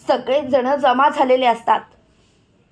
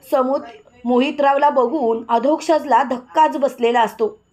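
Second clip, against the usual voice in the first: usual speaking voice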